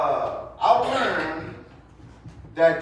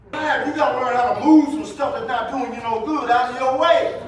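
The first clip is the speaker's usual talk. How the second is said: loud, higher preacher's build; preaching at full force; 6 semitones higher, 6 dB louder than usual. Acted. Preacher pressing the congregation toward Jesus, full-force preaching. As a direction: raise it to a fervent preaching peak.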